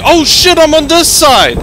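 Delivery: high, loud startled exclaim; excited shout. Real, not acted. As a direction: yell it with sudden surprise. Realising position, still shouting.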